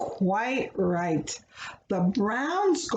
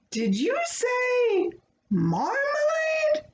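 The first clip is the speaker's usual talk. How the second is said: much higher, slow disbelieving question; incredulous; 13 semitones higher than usual. A narrator's reading. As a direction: ask it as if stunned. Foreman can't believe it.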